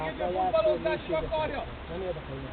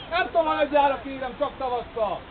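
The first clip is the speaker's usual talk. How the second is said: ordinary talk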